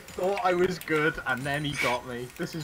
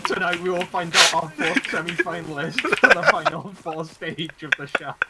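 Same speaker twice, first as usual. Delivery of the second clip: announcer voice; 3 semitones higher, 6 dB louder than usual. Pretend. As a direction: hype caster voice. Mock tournament host intro.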